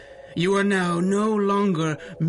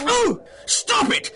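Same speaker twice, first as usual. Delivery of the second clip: pained cry. Acted. Villain pleading with the heroes to stop hitting him, high pained yelp. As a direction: yelp as the blows land.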